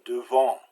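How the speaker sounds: devant with a short pursed-lip first vowel; French devant, 'duh-vahn' with a nasal ending; soft French schwa. Shown as a demonstration. Teacher giving French examples, soft short schwa. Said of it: "real soft and short".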